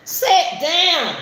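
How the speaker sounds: sharp, loud, high command to the dog; yelling at the dog. Real, not acted. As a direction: bark it at the dog to sit. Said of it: "I'm talking to the dog".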